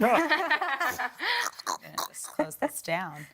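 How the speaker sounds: laughing whisper under the breath; breathy laughing whisper, half to self. Real, not acted. Aside while closing a call.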